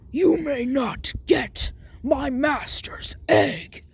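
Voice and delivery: slow, rasping whispered roar; ogre. Acted. Ogre warns Deku away from the master's egg, slow rasping growl. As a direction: growl it slowly in a breathy ogre voice. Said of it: "And the ogre says".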